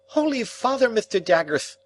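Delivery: high, loud lisping outburst; startled client crying out with a lisp; excited lisping cry. Acted. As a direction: blurt it out in alarm with a heavy lisp. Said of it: "cried my excitable acquaintance".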